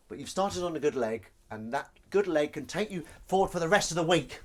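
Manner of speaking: rally them like a life coach; hammed-up motivational send-off; mock inspirational speech.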